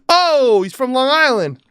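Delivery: loud, high mock Long Island accent; exaggerated New York holler. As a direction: crow it in a hammed-up New York voice. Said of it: "It's like New York accent".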